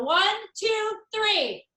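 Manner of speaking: high, loud count-in; cheer countdown. Real, not acted.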